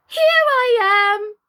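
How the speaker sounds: high, loud cheery call; cry from the tureen. A narrator's reading. Queen calling to Alice from the soup tureen, loud and high. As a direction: pop up and call out gleefully. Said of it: "cried a voice from the soup tureen".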